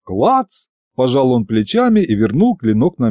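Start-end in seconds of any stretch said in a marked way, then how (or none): 0.0-0.7 high, light questioning clack; shrugging clack. A narrator's reading. Diesel shrugs and sheathes the blade, high offhand clack. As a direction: toss КЛАЦ up high like a shrug.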